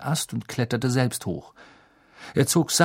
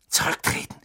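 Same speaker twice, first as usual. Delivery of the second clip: urgent whisper. Acted. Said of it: "rief ihm Hermine zu".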